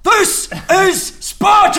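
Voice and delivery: huge, high, slow shout on every word; full battle roar. Acted. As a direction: bellow it word by word like a movie war cry. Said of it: "give [name] one more chance at delivering that properly".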